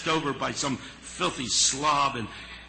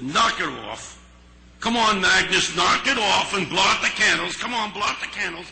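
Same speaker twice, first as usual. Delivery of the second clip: gruff urging; 6 semitones higher, 9 dB louder than usual. Retold or imitated. Harry urging crying Agnes.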